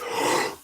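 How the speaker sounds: quick startled intake of breath; sudden shocked gasp; sharp gasp. Retold or imitated. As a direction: gasp as if something just slammed the car. Loud noise hits the car.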